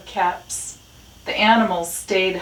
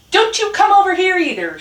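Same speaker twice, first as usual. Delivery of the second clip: high, loud shouted retort; shouting; 13 semitones higher, 8 dB louder, faster than usual. A narrator's reading. Rat answers Dog across the junkyard, shouting high. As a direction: shout back, pitched above the bark. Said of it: "shouted Rat".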